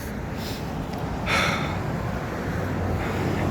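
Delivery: soft exasperated sigh; long weary sigh. Real, not acted. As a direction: let out a long tired breath. Long sigh about carcinogens.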